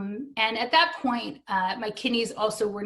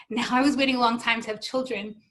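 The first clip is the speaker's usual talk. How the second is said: ordinary delivery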